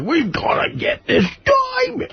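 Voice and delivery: high, slow crying shout; dramatic movie hero. Retold or imitated. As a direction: overwrought action-hero wail. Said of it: "the commercial's like".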